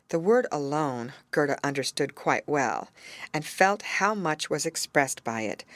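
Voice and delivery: ordinary delivery, nothing marked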